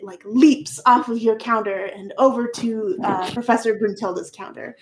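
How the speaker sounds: ordinary talk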